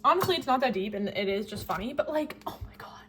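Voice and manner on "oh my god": whisper. Real, not acted. Reliving the embarrassing moment.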